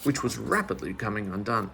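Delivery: ordinary delivery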